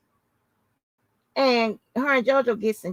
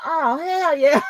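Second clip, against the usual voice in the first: hyped; 5 semitones higher, 4 dB louder, slower than usual. Retold or imitated. Hyping the live drama.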